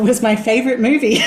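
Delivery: laughing through the words; giggling at her own silly story. Real, not acted. Guest laughing while telling interviewer about her childhood crush.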